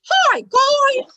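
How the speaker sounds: very high, loud puppet hello; high-pitched childlike spirit voice; Tommy. Acted. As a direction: belt out a squeaky ventriloquist greeting. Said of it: "that's his go-to hello".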